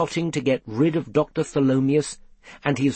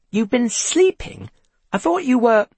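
high, raised, indignant question; incredulous; 10 semitones higher, 4 dB louder than usual. A narrator's reading. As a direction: scold a napping pet, pitch jumping up. Seth indignant at napping cat.

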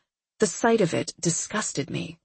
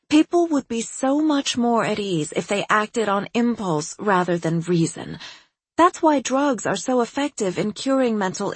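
higher, breezy, self-assured psychiatrist; eccentric doctor voice; 4 semitones higher than usual. Acted. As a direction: play her as a cheerfully odd doctor. Narrator voices quirky doctor.

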